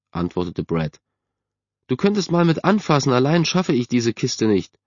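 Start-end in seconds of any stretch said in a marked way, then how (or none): 1.9-4.8 high-pitched, demanding mother; mother calling. A narrator's reading. Mother wants her son's help with a box, calling. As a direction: call for help in a raised pitch.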